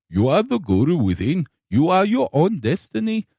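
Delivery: lightly put-on Indian accent, guru-like; a hint of an Indian guru's lilt; mock Indian accent. Coworker's Indian guru lines in a mild Indian accent. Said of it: "mimicking what was said".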